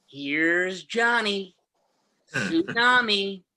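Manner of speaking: very slow, drawn-out menacing Nicholson; Jack Nicholson impression. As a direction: stretch each word like Nicholson. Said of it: "Jack Nicholson watching his favorite Disney Channel movie".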